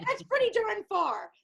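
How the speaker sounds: high and loud, emphatic on pretty; pretty darn far, really impressed; amazed. Real, not acted. Host amazed by four-book saga.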